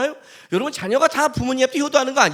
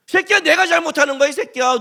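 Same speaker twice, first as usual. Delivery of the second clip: mock drunk yell; 5 semitones higher, 4 dB louder than usual. Drunk friend fight acted out.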